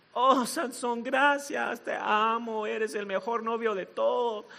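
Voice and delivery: lightly voiced sweet girlfriend; a hint of syrupy mock affection; gushing girlfriend. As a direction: coo thanks to a boyfriend. Acting girlfriend sweetly thanking Samson.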